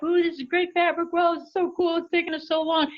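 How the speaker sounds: high, gushing delight over the fabric; excited coworkers. Retold or imitated. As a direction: imitate the team cooing over it.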